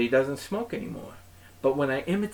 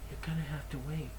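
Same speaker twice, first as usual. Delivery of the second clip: mocking mutter; 11 dB quieter, faster than usual. Muttered repeat of clerk's line.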